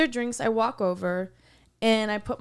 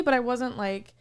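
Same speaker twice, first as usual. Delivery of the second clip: ordinary delivery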